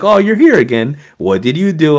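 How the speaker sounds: high, loud mock-principal greeting; imitated friendly principal. Principal voiced greeting a regular.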